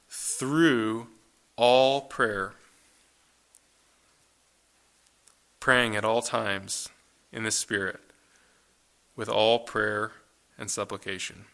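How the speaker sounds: slow, weighty reading of the verse; scripture reading. Acted. Preacher reading the verse aloud to the congregation, slow and solemn. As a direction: lay each phrase down with long pauses.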